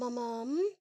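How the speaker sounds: ordinary delivery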